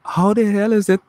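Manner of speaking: high-pitched baffled exclamation; baffled, voice jumping high; incredulous. Retold or imitated. Visitor baffled by London's summer cold, incredulous.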